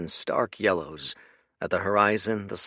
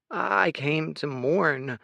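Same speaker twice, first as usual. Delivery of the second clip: put-on higher, slow and bewildered child; a lost little boy, slowly; boy's voice; 8 semitones higher, much slower than usual. Boy answering the patriarch, higher and slow.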